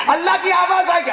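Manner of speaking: high, ringing proclamation; impassioned preaching. Acted. Preacher announcing Allah's voice on Judgement Day, impassioned.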